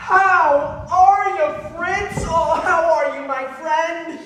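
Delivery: loud, slow, gushing welcome; hearty greeting. Acted. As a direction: greet him like an old pal.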